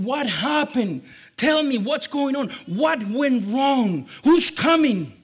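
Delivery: urgent, high-pitched acted demands; alarmed questioning. Dad climbing down to question the lookout, alarmed. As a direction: fire off worried questions, drawing out key words.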